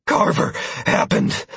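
breathless, almost whispered; breathing heavily. A narrator's reading. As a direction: gasp it out, winded. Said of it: "breathing heavily".

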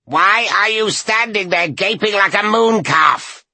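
high screeching hoarse crone; hoarse bird cry. A narrator's reading. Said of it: "more like a bird's hoarse cry".

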